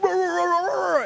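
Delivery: high, loud mock dog bark; imitated frantic barking. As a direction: bark like a dog going nuts. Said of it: "this dog just go nuts".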